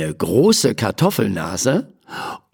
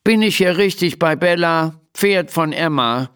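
higher, grumpy little voice; 6 semitones higher than usual. A narrator's reading. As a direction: ask it peevishly, put out. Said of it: "in verdrießlichem Ton". (verdrießlich, mürrisch fragend)

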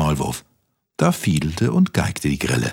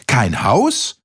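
high-pitched incredulous questions, then dismissive refusal; outrage peaking high on the noes; indignant mouse; 6 semitones higher than usual. A narrator's reading. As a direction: act an appalled mouse turning a beggar away. Shocked mouse refuses the cricket.

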